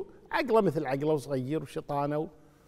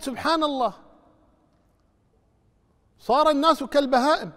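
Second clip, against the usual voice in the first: loud, higher admonishing sermon; fiery preaching; 10 semitones higher, 8 dB louder than usual. Acted. Preacher rebuking mourners who laugh at graves, fiery. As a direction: thunder the warning at the crowd. Preaching warning at full force.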